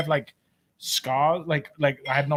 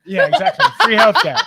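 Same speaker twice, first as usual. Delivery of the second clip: laughing; 7 dB louder than usual. Real, not acted. Laughing joking.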